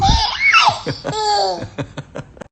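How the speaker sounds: loud high burst of laughter; delighted laugh. Real, not acted. Mother laughing with glee after peekaboo, high loud laugh.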